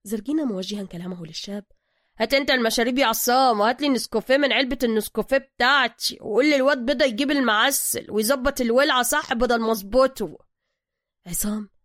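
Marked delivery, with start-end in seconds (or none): 2.2-10.4 gruff master mechanic. Acted. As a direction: greet him like you own the place. Zergina orders Essam.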